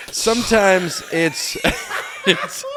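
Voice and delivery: laughing. Real, not acted. Breaks up laughing mid-sentence.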